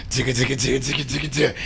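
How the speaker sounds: quick, soft ticking noise; imitated walker rattle. Showing the crowd how the regular came out, imitated ticking. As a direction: rattle out light, rapid ticks. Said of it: "try to show y'all what he's doing".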